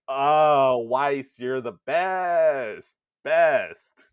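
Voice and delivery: loud, drawn-out gushing cry; gushing adoration. Acted. Player adoring the joking book, loud gushing praise. Gushing fan reply to Weiss.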